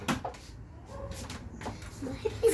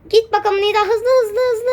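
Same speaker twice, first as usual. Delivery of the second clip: louder, higher urging; excited urging; 3 semitones higher, 7 dB louder than usual. Real, not acted. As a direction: egg her on to go faster. Urging friend to go fast.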